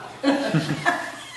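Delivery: short high burst of laughter. Real, not acted. Old man laughing at his own joke about being senile. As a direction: laugh at yourself, high and quick.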